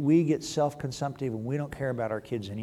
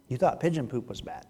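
normal talking voice

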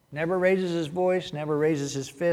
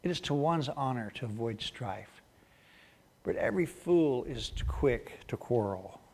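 soft, low scripture reading; reflective repetition; 4 semitones lower, 8 dB quieter than usual. Real, not acted. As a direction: let it sink in, hushed and low. Proverb repeated to let it sink in.

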